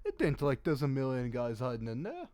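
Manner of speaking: shifty merchant insisting to an officer, put-on Slovenian accent. Merchant lying to an officer, in a put-on Slovenian accent. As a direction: protest innocence in a mock Slovenian accent. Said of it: "I can do a Slovenian accent".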